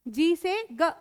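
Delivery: ordinary talk, nothing marked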